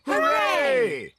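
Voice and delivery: high, happy hooray; cheer. Acted. Celebrating that the scarecrow worked, cheering.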